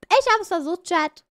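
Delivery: ordinary talk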